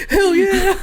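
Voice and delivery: high, loud, laughing cheer; mock whoop. Cheering on imagined comeback to insult.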